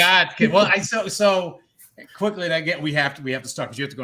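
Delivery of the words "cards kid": louder, higher Boston catchphrase; Boston ah, dropped r, called out; Boston accent. Shown as a demonstration. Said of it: "I play off it, the card thing".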